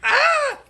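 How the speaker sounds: a very high, loud hammed-up scream; mock shriek when tased. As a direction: squeal like a cartoon jolt of electricity. Woman tased again.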